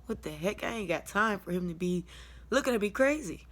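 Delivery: usual speaking voice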